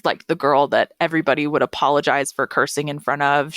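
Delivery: normal talking voice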